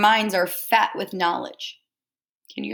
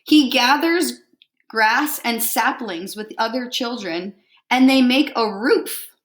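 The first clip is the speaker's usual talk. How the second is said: lively picture-book read-aloud; reading to children; 2 semitones higher, 4 dB louder, slower than usual. Acted. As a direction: read it out warmly to a class.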